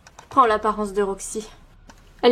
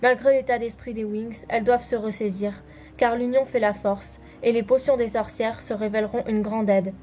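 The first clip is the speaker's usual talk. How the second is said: dramatic next-episode voiceover; trailer narration; 5 dB quieter than usual. Acted. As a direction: tease the next episode with suspense.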